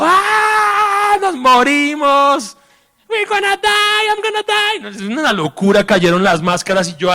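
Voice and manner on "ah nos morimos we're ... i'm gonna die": high, panicked mock scream of passengers. Passengers in the falling plane, screaming in panic. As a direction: shriek it like you're falling. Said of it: "la gente al lado gritando".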